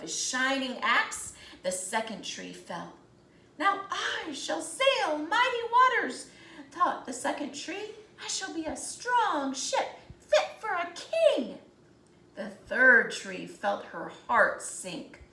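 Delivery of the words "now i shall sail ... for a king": much higher and louder, bright with hope; eager dream. Acted. Second tree imagining mighty waters, higher and eager. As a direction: soar on the dream.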